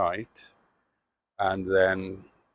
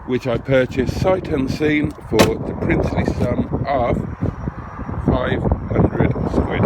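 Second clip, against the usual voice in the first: mock grandeur; 4 semitones higher, 6 dB louder than usual. Mock-grand description of cheap car.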